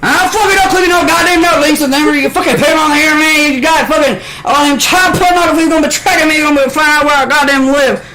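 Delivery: very high, loud, ranting Southern voice; aggressive Southern accent. Retold or imitated. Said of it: "talking that fucking old-fashioned Southern".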